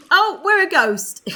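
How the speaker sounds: high, loud laugh of surprise; laughing delight. Real, not acted. Streamer sees her sim become a ghost, laughing loudly.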